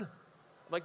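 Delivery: ordinary delivery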